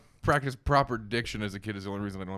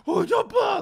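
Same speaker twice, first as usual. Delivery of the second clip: high delighted squeal; excited squeal; 6 dB louder, slower than usual. Retold or imitated. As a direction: squeal like a child at a dog. Excited shriek at a big dog.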